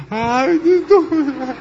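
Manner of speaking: high-pitched squeal at the price; aghast, pitch leaping way up; mock dismay. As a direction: wail it in hammed-up shock.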